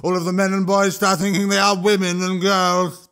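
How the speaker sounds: high, loud troll rant; a troll shouting over the crowd. Acted. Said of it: "Throch himself was shouting".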